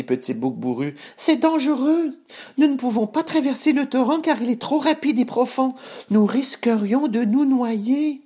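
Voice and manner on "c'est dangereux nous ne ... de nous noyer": very high-pitched small goat voice; tiny goat fretting, very high; little billy goat, worried. Acted. Voices Petit Bouc in the tale.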